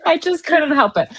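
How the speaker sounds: embarrassed laugh while apologizing. Real, not acted. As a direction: giggle the words out. Giggling apology after sneeze.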